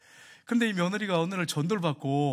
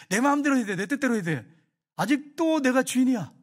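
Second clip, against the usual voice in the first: stubborn demand; 6 semitones higher, 5 dB louder than usual. Retold or imitated. Selfish inner voice quoted.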